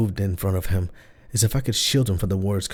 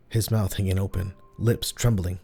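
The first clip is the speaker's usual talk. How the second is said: ordinary delivery, nothing marked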